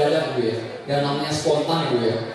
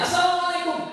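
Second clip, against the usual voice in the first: greeting pitched much higher, showing tune. Shown as a demonstration. Trainer demonstrates pitch range to the class, lifting it high. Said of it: "Kapan tinggi rendahnya?".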